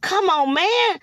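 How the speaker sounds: ordinary talk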